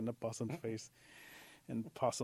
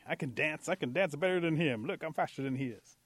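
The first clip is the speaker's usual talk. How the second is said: raised, louder pleading boast; jealous protest; 5 semitones higher, 7 dB louder than usual. Acted. Rabbit passed over by the girls, protesting. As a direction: insist you are the better catch.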